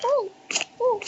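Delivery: usual speaking voice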